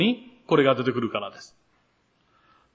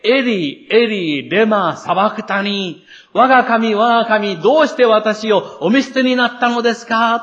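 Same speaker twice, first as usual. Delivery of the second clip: higher, louder cry of forsakenness; anguished cry; 6 semitones higher, 3 dB louder than usual. A narrator's reading. Jesus crying out to God from the cross, anguished. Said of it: "大声で".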